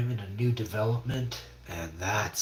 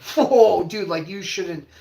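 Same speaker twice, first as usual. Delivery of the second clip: alarmed; 8 semitones higher, 9 dB louder, slower than usual. Retold or imitated. Therapist voiced alarmed.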